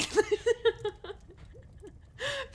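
very high, loud squealing laugh; giddy laughing. Acted. Thrilled to be all over internet, giddy laughing. Elated about being read online.

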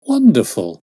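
loud, high burst of amazement; a loud amazed cry; excited exclamation. Acted. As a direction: blurt out "Wonderful!" in delight. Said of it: "I ejaculated".

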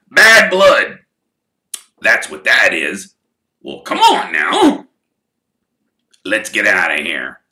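a big, loud half-grumbling voice with coughs; gruff giant Hagrid. Acted. Gamekeeper grumbling to Arthur after the fight, gruff. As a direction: scold like a burly gamekeeper.

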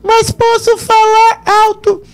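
demonstrating a high voice; high voice demo. Shown as a demonstration. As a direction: jump up into a thin high voice. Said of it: "posso falar alto porque minha voz está aguda".

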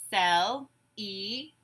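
"Celebrate" in three slow, separate beats; syllable by syllable. Shown as a demonstration. Teacher gives syllables to blend, "Celebrate" broken apart. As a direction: say "Celebrate" as cel, e, brate, pausing between. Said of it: "I will say syllables in a word".

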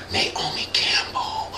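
unvoiced whisper; hushed dread. Comic naming a feared model, spooked whisper. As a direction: whisper the name as if she might hear. Names feared celebrity in hush.

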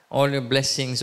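normal talking voice

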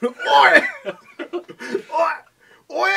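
high, loud laughing pirate shout. Pretend. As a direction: bellow like a cartoon pirate. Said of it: "That sounds pirate".

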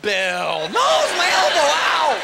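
very high, loud comic wailing; pained, embarrassed friend. Acted. Injured wrestler crying out over his elbow, mock agony. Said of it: "Embarrassment and pain".

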